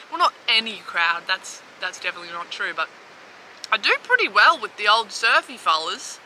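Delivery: ordinary delivery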